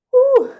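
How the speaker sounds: sharp, high breath out, holding back tears; high shaky exhale. Real, not acted. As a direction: blow out a high breath to stop crying. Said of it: "I'm trying not to sound like I'm crying".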